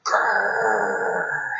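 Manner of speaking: low playful growl; mock growl. Shown as a demonstration. Teacher modelling a growl for the class. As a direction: growl like a grumpy bear.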